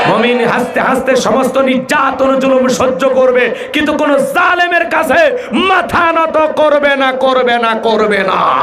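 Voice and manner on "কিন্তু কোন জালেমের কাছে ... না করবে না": impassioned shout. Acted. Preacher to congregation, defiant slow shout. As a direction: hammer the refusal home, slowing down.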